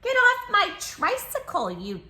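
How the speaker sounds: put-on high, loud, bossy child; indignant shout. Child ordering the tiger away, high bossy shout. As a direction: shout him off the tricycle.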